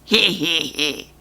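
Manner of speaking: low mischievous goblin giggle; tee-hee like a sly goblin; goblin cackle. Acted. Goblin cackling at the castle damage, low and sly. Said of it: "the goblin cackled".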